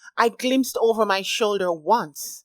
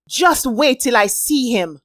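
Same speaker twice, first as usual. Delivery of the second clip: frustrated; 5 semitones higher, 5 dB louder than usual. A narrator's reading. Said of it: "grunting in frustration".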